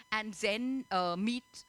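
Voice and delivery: usual speaking voice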